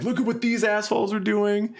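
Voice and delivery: high-pitched tattletale call-out; mock tattling. Musician acting out his post tagging the festival, tattling.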